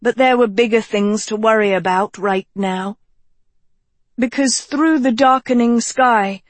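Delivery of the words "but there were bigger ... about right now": ordinary delivery, nothing marked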